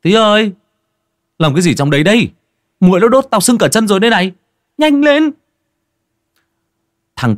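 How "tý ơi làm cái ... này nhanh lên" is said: high, loud call from outside; calling out loudly. Acted. Den calling Ty from outside, loud and impatient. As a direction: shout for a friend to hurry up. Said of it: "có tiếng thằng đen ở bên ngoài gọi".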